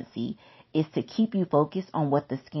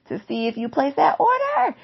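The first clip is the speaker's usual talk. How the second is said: emphatic outburst; 10 semitones higher, 4 dB louder than usual. Real, not acted. Emphatic warning to viewers.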